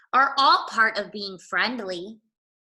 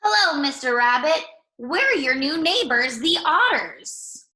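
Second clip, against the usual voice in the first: a bright, higher, louder friendly otter voice; cheerful otter greeting; 6 semitones higher, 4 dB louder than usual. Acted. Otters greet the rabbit next door, cheerful higher voice. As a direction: greet the new neighbor brightly.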